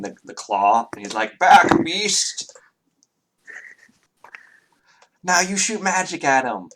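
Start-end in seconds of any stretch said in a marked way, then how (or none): 1.4-6.7 put-on high, loud, slowed-down child voice in play; a little boy hamming up a monster game; excited kid.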